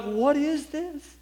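put-on high, slow, wondering child's voice; amazed child's question. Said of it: "I began to weep".

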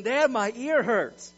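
put-on high, loud child's wail, drawn out; mock crying. Son cries out to his dad about his ear, mock crying. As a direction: wail it slowly like a hurting little boy. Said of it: "he was crying and he says".

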